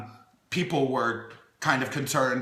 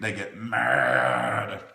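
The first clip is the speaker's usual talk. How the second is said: much lower, slow, playful grumble; mock mad growl; 13 semitones lower, much slower than usual. About nieces denied gunkle treats, mock mad growl. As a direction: growl it out like a cranky toddler.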